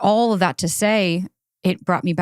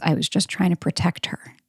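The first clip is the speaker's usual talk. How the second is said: whispered anxiety voice. Retold or imitated. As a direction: whisper it like a timid inner worry. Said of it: "the little anxiety says".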